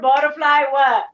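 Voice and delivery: ordinary delivery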